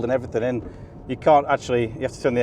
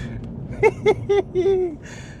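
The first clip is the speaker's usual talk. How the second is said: high-pitched delighted laugh; high giddy laugh. Real, not acted. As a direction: laugh with real joy. Thrilled on track.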